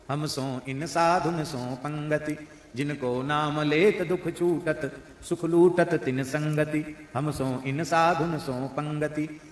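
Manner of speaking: slow, half-sung devotional recitation; slow devotional verse. Acted. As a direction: lift the pada slowly like a hymn.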